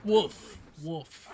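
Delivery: barking woofs; dog voice. Shown as a demonstration. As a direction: bark like a little dog. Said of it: "I would love to voice a dog".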